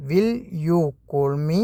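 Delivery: "Will you call me?" in one very slow run with a rising end; slow whole phrase. Shown as a demonstration. Teacher giving the full question to learners, very slow. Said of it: "അതെങ്ങനെയാണ് പറയുക?".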